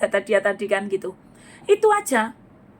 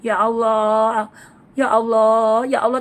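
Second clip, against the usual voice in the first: mock drawn-out lament, slow and slightly louder; imitating troubled plea; 2 semitones lower, 3 dB louder, slower than usual. Preacher imitating someone in trouble crying out, mock lament. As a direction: ham up a troubled person's cry to God. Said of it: "kalau lagi dikasih masalah pasti gini".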